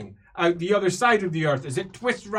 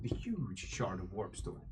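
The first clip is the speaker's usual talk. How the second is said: whisper; 9 semitones lower, 15 dB quieter than usual. Shown as a demonstration. GM corrects large to huge.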